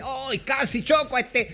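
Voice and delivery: mock startled driver. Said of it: "hay gente que dice".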